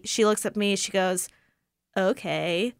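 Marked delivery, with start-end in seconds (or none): 2.0-2.7 soft, indifferent rising okay; low-energy, questioning mimicry; millennial "okay". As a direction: imitate an uninterested millennial. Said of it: "Like, the most millennial "okay".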